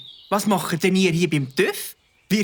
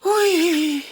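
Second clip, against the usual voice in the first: be properly impressed; 10 semitones higher than usual. Acted. Friend hearing Mike promised the police, high and impressed.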